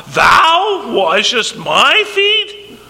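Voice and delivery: "Thou washest my feet?" very slow, every word hit, "Thou" loudest; emphatic stress on every word. Retold or imitated. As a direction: shout it out, pressing "Thou" above all. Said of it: "emphatic emphasis in every one of those words".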